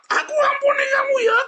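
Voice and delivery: very high, loud mock yell; hammed-up shrieked forgiveness; shouting. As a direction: scream out the forgiveness at full pitch. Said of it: "Kalau dua-duanya sambil teriak".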